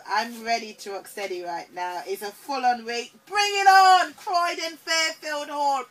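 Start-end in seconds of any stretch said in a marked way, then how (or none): 3.3-5.8 hyped, loud and high; excited. Real, not acted. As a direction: pump up the crowd. Hyping the show.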